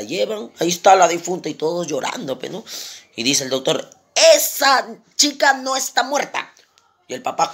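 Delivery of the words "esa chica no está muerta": slow, high-pitched pronouncement; emphatic declaration. Acted. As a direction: announce it slowly, word by word. Doctor declara solemne que vive. (declaración enfática, lenta y aguda)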